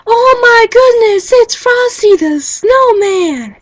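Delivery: put-on high, loud, gasping kid excitement; excited kids. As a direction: squeal it like a thrilled little kid spotting Frosty. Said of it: "Other kids are all like".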